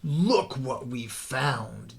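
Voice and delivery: slow, sly villain voice, lightly put on; a mangy coyote boss gloating over his catch; slow gloating coyote duke. A narrator's reading. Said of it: "the Duke did declare".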